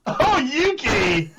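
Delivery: high, drawn-out excited cry; excited exclaim. Real, not acted. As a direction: call her name out in shock. Caster exclaims at elimination.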